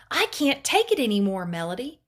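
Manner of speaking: loud exasperated scream, easing on the name; screaming over thunder. A narrator's reading. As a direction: cry out at the end of your rope. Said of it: "Mom screamed over the thunder".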